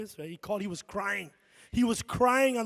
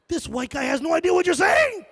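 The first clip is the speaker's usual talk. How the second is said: put-on high, loud childish protest; kid shouting; 8 semitones higher, 7 dB louder than usual. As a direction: shout it at mom like a little kid. Kid protesting to his mom.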